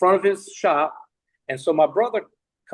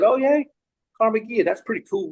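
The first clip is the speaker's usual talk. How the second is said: higher-pitched, mildly excited reply; retold keen interest; 7 semitones higher than usual. Reacting to brother's car idea, mildly excited. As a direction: perk up as you quote yourself.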